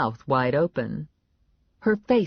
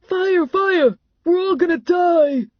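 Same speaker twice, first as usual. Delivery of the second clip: high, loud alarm shout; full-voiced, high-pitched panic; panicked yell; 7 dB louder, slower than usual. A narrator's reading. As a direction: yell fire to the whole room. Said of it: "Clown yelled".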